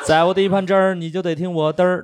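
put-on Beijing accent. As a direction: sing out the slogan with Beijing erhua. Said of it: "老师从小不让我们说的话".

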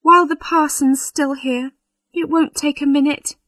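high-pitched, coaxing bride; anxious. A narrator's reading. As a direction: urge him nervously not to delay. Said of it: "said the bride anxiously".

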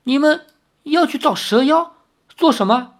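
high, incredulous questioning; doubtful old man. A narrator's reading. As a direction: ask warily in a high voice, puzzled. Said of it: "那老头狐疑地看着他们". (狐疑地高声问)

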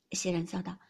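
usual speaking voice

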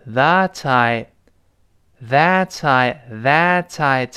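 THAT I linked with a crisp T, "tha-tai"; fully sounded t. Shown as a demonstration. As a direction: carry a clear T from THAT onto I. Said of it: "本来理论上应该读成". (that I连读，t读清楚)

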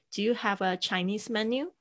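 "Do you have a Chinese menu?" rising at the end; rising question tune. Shown as a demonstration. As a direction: lift "menu" up as a yes-no question. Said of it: "语调上扬". (语调上扬的问句)

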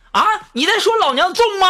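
angry wife. Retold or imitated. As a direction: snap back as an offended wife. Wife slaps him, angry retort. (老婆尖声怒斥)

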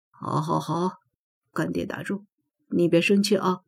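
soft, backing-down godfather; calm her down quietly, giving in; placating. Acted. Godfather gives in to his annoyed goddaughter, soft placating voice.

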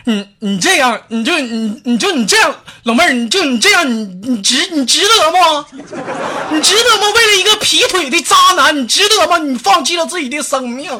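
high, loud, hammed-up pleading; stammering plea. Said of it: "就说道".

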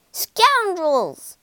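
high, loud insult hurled out; furious shout. A narrator's reading. Rat yelling after the car that hit them, furious shout. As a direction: yell it after them in fury. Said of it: "he shouted".

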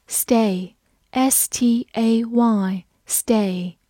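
slow, spelled letter by letter; slow spelling. Shown as a demonstration. Teacher spelling a word for students. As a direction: spell it out slowly. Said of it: "spelling the word out".

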